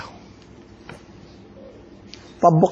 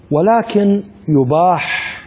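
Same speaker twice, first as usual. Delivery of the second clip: slow emphasis; much slower than usual. Shown as a demonstration. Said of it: "slow emphatic ruling".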